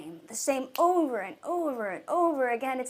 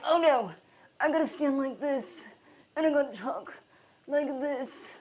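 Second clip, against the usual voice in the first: lightly mocking a fear-bound presenter; stiff, self-conscious mock presenter; mildly acted stiff speaker. Speaker mimicking a fearful on-camera presenter, mildly stiff. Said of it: "stuck into this fear mentality".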